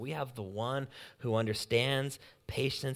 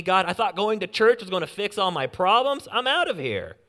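loud, exasperated rant, pitched up; fed-up complaining; 7 dB louder than usual. Acted. A frustrated believer complaining aloud to God, loud and exasperated. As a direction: vent like you've given up waiting on God.

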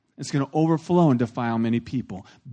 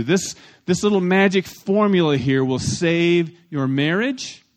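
ordinary talk

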